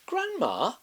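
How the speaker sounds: put-on high, bright child calling; little girl voice. A narrator's reading. Girl at grandma's door, high child's call.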